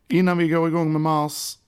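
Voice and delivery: high, held-up announcing tone; odd put-on delivery. Real, not acted. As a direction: launch in high and punchy, almost on one note. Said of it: "varför gjorde jag så".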